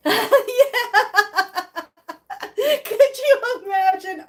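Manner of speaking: high loud burst of laughter; delighted laugh at the thought of a visit. Real, not acted. Laughs at Ashley surprise idea.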